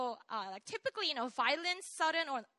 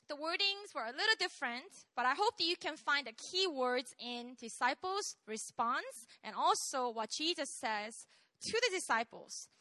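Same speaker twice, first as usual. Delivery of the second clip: ordinary delivery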